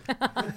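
burst of laughter. Real, not acted. Laughing at Canadian joke.

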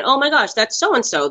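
high-pitched mock surprise; surprised recognition. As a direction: act out spotting a famous face. Acting out viewer spotting familiar actors.

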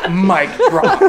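smug mic drop. Retold or imitated. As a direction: drop it like you just won. Smug ending of argument.

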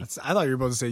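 ordinary talk

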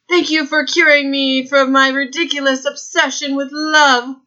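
high, overwrought parody of the rejected lover; a sarcastic movie-hero voice; mock lovesick hero. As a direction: ham up the grateful heartbreak. Mocking the lover's reply.

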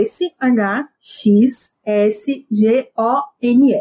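slow, letter by letter; spelling it out. Shown as a demonstration. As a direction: spell each letter slowly and clearly. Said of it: "eu vou soletrar aqui".

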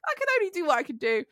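high, carefree shrug of a voice; chilled out. Retold or imitated. Patient joking with paramedics, carefree high voice. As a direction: shrug it all off. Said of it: "I'm quite chilled out at this point".

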